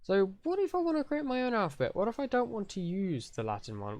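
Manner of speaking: high-pitched put-on questioner; whiny objecting viewer. Teacher voices a viewer wanting their own alphabet, high mock whine.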